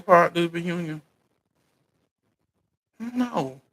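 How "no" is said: ordinary delivery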